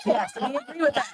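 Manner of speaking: high laughing reply. Real, not acted. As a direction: laugh through the words.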